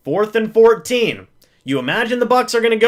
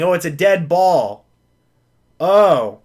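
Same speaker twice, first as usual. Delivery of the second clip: usual speaking voice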